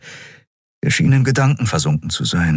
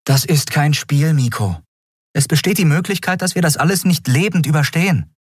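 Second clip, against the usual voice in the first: warning captain; 10 semitones higher than usual. A narrator's reading.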